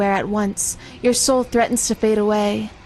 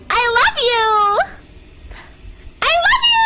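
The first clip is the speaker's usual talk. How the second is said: much higher, sugary, bright-eyed i love you; happy voice; 12 semitones higher, 3 dB louder than usual. Pretend. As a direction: gush it like an overjoyed cartoon. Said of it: "a happy, happy, happy voice".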